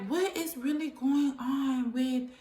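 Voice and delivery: lightly voiced, baffled nurse; puzzled nurse voice. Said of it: "So they was like".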